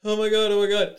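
high, loud retold fan panic; imitating a breathless fan freak-out; starstruck panic. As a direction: gush it like an overwhelmed fan. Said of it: "I had to deep breathe 'cause I nearly wet myself".

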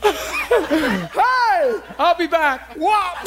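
high, giggling through it; laughing quote. Retold or imitated. Preacher still laughing as he quotes the parting line, high. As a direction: toss it off while still laughing.

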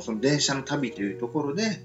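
ordinary delivery

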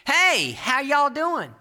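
loud, high, drawn-out folksy hello; hearty greeting. Retold or imitated. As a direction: greet the table like an overfriendly stranger. Stranger's hearty greeting acted out.

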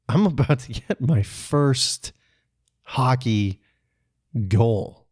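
slow, with long dramatic pauses; drawn-out suspense. Real, not acted. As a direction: stretch each word before the big moment. Suspense before his first goal.

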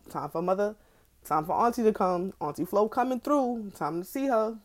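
ordinary talk

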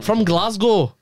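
mock Scottish; Scottish accent. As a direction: announce it as a proud Scot.

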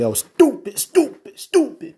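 hammed-up impression of a furious wrestler's yell; Randy yelling stupid. Wrestler berates himself, copying a mock furious yell. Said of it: "Like Randy said to Kofi".

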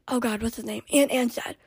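ordinary talk, nothing marked

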